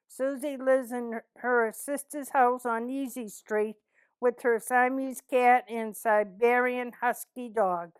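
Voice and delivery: normal talking voice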